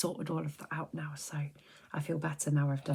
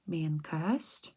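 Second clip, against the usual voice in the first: usual speaking voice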